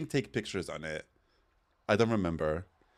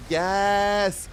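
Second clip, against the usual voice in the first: squealed yes, then a sharp gasp; high excited shriek then gasp; 11 semitones higher, 4 dB louder than usual. Real, not acted. Vlogger thrilled at the iPod Nano, squealing.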